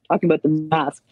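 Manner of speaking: ordinary delivery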